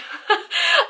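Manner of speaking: very high burst of laughter. Real, not acted. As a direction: crack up in a high-pitched laugh. Laughs at the quoted insult.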